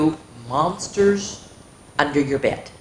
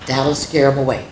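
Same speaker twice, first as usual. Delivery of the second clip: ordinary delivery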